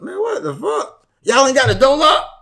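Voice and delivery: high-pitched indignant question; incredulous. Retold or imitated. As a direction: ask it in outraged disbelief, pitch jumping. Indignant retold reaction to the scare.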